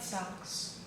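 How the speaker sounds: ordinary delivery, nothing marked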